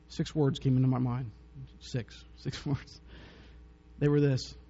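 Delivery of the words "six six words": whispered echo with a small laugh; hushed, amused whispered repeat. Shown as a demonstration. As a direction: breathe the words, much softer. Echoes "six words" hushed.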